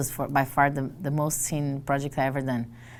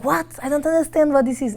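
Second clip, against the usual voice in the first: high, loud bewildered cry; baffled and loud; confused exclamation; 12 semitones higher, 8 dB louder than usual. Retold or imitated. Confused by the London accent, in a high loud cry.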